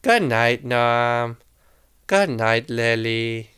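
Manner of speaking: low, soft bedtime voice; tender good night. Real, not acted. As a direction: send them to sleep lovingly, low and slowing. Parent says good night to children.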